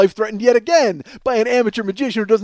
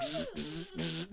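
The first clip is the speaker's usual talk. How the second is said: soft electrical zapping hiss; short bursts of fizzing static; imitated sign buzz; 10 semitones higher, 19 dB quieter, slower than usual. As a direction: mimic a broken neon sign. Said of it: "The sign is like".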